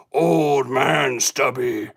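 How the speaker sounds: very low, slow growl; spooky deep voice. Pretend. Host naming the puppet as a horror villain, deep slow growl. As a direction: drag it down like a monster.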